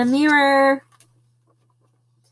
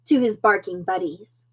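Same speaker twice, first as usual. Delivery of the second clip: usual speaking voice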